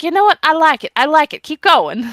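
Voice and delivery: light Turkish accent impression; imitating Turkish accent. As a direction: do a friend's Turkish accent, upbeat and approving. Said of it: "he sounded Turkish".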